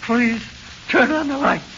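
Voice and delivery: high, loud, frantic plea; desperate begging. Acted. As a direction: scream for the lights in terror. Terrified prisoner begging in darkness.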